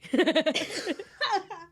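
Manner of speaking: loud, high burst of laughter; real laughing burst. Real, not acted. Listener laughs at the twist.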